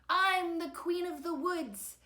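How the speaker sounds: high, loud proclamation; bellowing. A narrator's reading. Mom flies to Victor's rescue, bellowing. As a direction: proclaim it boldly at full voice.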